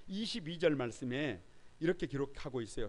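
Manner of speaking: ordinary talk, nothing marked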